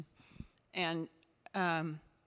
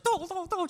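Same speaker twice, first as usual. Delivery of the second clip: bouncy boing sounds; 10 semitones higher, 4 dB louder than usual. Retold or imitated. Pogo-stick bouncing woman imitated.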